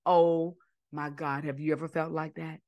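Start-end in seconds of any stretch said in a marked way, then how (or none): none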